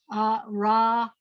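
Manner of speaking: "uh, ra" as separate syllables ending on the vowel; open syllables. Shown as a demonstration. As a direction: leave each of "uh, ra" open on its vowel. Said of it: "end it with a vowel sound".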